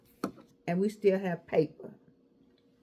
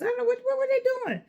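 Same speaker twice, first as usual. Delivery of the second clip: incredulous retelling; much faster than usual. Retold or imitated. Retelling the others still writing.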